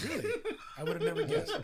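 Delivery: high, loud, laughing "never guessed"; laughing sarcasm. Real, not acted. Joking to friend who knows the story, laughing. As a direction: tease him through a laugh.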